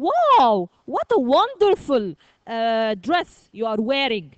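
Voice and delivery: wide-eyed amazed exclamation; great surprise. Shown as a demonstration. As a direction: gush over a friend's dress. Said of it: "Here I'm showing great surprise".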